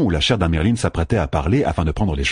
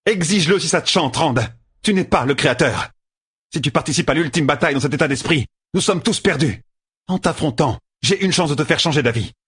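much higher, fierce female voice; Egwene defying Rand; 8 semitones higher than usual. A narrator's reading. Egwene as Amyrlin defying Rand before the rulers, fierce and high. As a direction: stand up to him with rising scorn.

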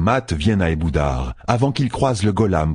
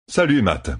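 lightly chipper greeting, pitched up; Rand, cheerful; 6 semitones higher than usual. A narrator's reading. Captive greets his old friend, cheerful. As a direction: toss out a breezy, higher-pitched hello.

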